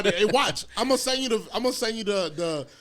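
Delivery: ordinary delivery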